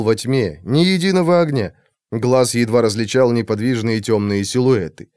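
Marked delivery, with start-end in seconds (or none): none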